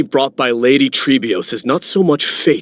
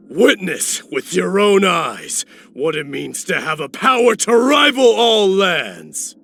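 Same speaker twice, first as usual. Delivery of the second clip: battle cry; 9 semitones higher than usual. Acted. Warrior vowing power to rival all lands, rallying battle cry. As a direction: proclaim it high, building to a long ringing cry.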